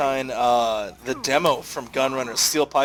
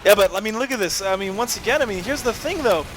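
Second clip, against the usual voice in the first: high energetic play-by-play; excited commentary; 8 semitones higher than usual. Real, not acted. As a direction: push the analysis hard. Heated live analysis.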